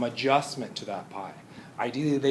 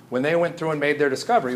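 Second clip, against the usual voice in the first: normal talking voice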